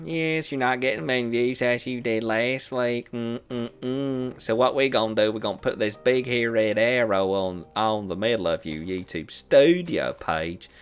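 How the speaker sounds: lightly voiced Southern speaker with a mild Southern accent; the speaker mocks YouTube's warning in a Southern accent, hammed-up. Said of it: "I don't know why YouTube has a southern accent".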